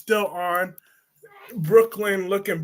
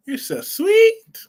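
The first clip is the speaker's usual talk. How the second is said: cooing high praise; 12 semitones higher, 5 dB louder, slower than usual. Acted. Cooing at child character.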